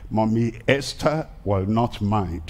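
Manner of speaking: ordinary talk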